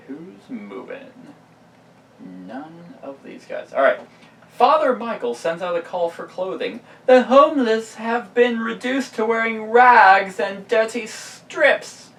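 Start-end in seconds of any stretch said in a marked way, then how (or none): 4.5-12.1 loud, high dramatic read; dramatic card reading. Acted. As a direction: boom it out like a herald. Reads event card in announcer voice.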